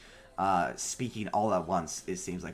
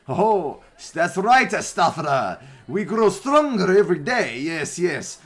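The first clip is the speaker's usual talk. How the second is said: loud high boastful strongman voice; booming strongman; 10 semitones higher, 10 dB louder than usual. Acted.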